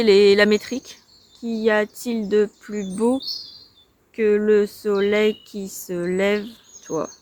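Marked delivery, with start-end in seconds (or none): 1.4-7.1 slow, syllable-by-syllable scansion; counting the metre. Shown as a demonstration. Vlogger checks the poem's metre, slow measured syllables. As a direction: measure out each syllable of the verse slowly.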